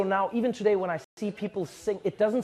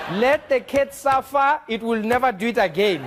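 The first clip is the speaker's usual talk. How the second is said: loud and slow, higher; mock stern operator; 5 semitones higher, 5 dB louder than usual. Operator refusing to rescue the cat, slow and loud. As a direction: lay down tough love slowly.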